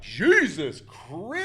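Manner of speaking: high, slow, stretched-out amazement; acted awestruck outburst; astonished exclamation. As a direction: draw it out in mock awe. Acted reaction to a beautiful actress.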